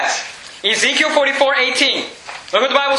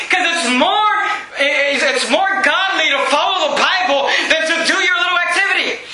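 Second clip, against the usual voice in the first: raised, pushing preacher's shout; fiery preaching; 6 semitones higher than usual. Acted. Preacher telling the church to follow the Bible, shouting. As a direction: drive the point home hard.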